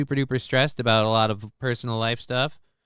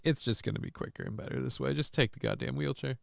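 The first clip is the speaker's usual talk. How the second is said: softer, quick, insistent murmur; hushed urging; 11 dB quieter than usual. Retold or imitated. Telling the husband to take the wheelchair, in a soft rush. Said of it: "But I was like".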